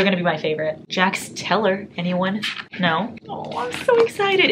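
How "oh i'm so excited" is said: excited squeal. Real, not acted. Squealing to the fan who sent the series, so excited. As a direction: let the joy spill out in a long squeal.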